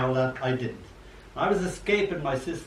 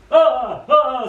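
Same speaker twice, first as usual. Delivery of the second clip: high loud alarmed cry; ball screaming; 10 semitones higher, 10 dB louder than usual. A narrator's reading. Ball screaming as the collie catches it, high and loud. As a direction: shriek as the dog grabs you. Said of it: "screams the ball".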